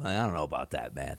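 low, dry doubt; low skeptical. Real, not acted. Host doubting he gets followers back, low and dry.

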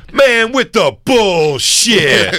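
high, slow mock complaint, laughing; hammed-up disgusted moviegoer; guy storming out. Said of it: "He goes".

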